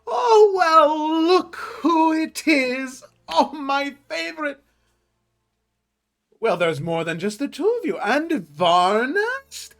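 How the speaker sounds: high, eager tailor greeting customers; shopkeeper voice. Acted. GM voicing shopkeeper Albert.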